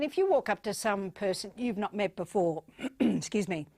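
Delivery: ordinary talk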